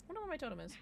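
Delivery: usual speaking voice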